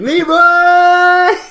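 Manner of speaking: long, high, loud yell of the name; Leroy battle cry. Acted. Jumping out of the parachute into the fight, a long war cry. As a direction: charge in yelling like a reckless hero. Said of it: "jumping out of my parachute".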